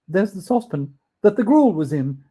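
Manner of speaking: loud, eager pointing out; excited cry. Acted. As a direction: cry out with delight. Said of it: "cried Scrooge".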